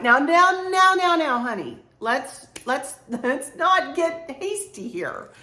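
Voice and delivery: high, drawn-out motherly "now, now"; cautioning mom. Retold or imitated. Mom reining in her smitten daughter, high cautioning imitation. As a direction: imitate a mom slowing down her eager child, voice lifted. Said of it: "And my mom went".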